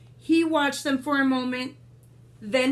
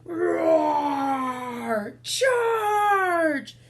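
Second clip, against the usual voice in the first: battle cry; 5 semitones higher than usual. Acted. Narrator shouting the battle cries of the war. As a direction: bellow it like a charging army.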